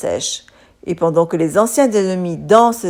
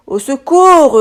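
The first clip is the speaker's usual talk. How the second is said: higher, louder mock panic; storybook cry for help; 6 semitones higher, 4 dB louder, slower than usual. A narrator's reading. Drowning cutlery calling out, hammed-up panic. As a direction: call for help from a sinking boat. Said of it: "hurlent les couverts".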